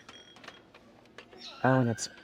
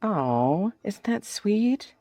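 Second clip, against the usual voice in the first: high cooing; 10 semitones higher, 3 dB louder, much slower than usual. Real, not acted. Cooing over a trivet.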